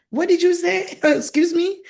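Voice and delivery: high-pitched incredulous comeback with a laugh; mock taken aback. Newly married person answering a spouse, mock taken aback. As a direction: act out amused disbelief, pitched well up.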